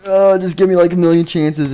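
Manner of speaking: loud, high mock complaint; exasperated protest. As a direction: whine back at a taunt, hammed up. Spider-Man protests being told he sucks.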